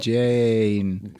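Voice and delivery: "Jane" held long and mechanical; robotic drawn-out vowel. Shown as a demonstration. Learner practising a Welsh accent, robotically stretched. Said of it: "You sounded like Johnny Five Alive".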